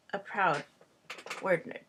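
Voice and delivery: ordinary talk, nothing marked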